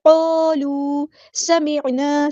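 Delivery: क़ालू with heavy qaf, समिअना drawn out; slow recitation. Shown as a demonstration. Teacher reciting to class, slow chanted. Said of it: "सीन की सीटी हम वाज़े करेंगे".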